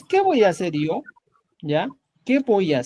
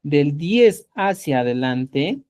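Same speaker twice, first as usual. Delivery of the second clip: slowed, deliberate repetition; teacher repeating the key phrase slowly for students, slow and deliberate; slow emphasis; 5 semitones lower, slower than usual. Shown as a demonstration. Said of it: "ya, recuerda esto".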